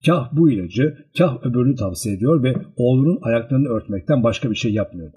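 plain reading voice

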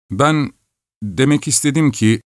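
plain reading voice

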